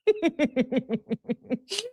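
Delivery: long, high, soft giggle; rapid high laughter, trailing on; helpless giggling. Real, not acted. Reacting to Jen's comment, high giggling.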